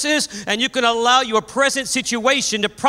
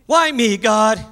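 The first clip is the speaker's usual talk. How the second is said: mock bitter complaint; 3 semitones higher, 3 dB louder, slower than usual. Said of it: "shaking your fist in the face of God".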